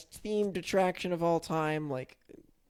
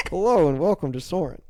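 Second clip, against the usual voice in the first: loud, sweeping announcer's hello; big, projected ride-host greeting; ride intro impression; 2 semitones higher, 7 dB louder than usual. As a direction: boom out a theme-park ride welcome. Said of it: "is that a pretty good voice".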